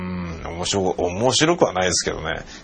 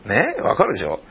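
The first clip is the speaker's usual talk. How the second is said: ordinary talk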